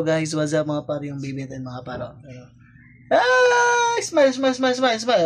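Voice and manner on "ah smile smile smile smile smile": high, loud coaxing sing-song. Real, not acted. Father coaxing his baby to smile on camera, high baby talk.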